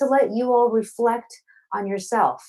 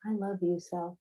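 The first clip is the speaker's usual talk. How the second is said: much softer, almost breathed to yourself; hushed affirmation; 11 dB quieter than usual. Shown as a demonstration. Poet guiding audience in self-love, now very softly. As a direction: say it again quietly, just for you. Repeated affirmation, dropped to hush.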